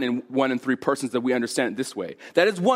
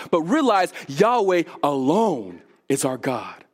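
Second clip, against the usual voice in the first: urgent, higher exhortation; fervent preaching; 5 semitones higher than usual. Acted. Pastor urging his flock away from false gods, fervent. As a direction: press the warning on the congregation.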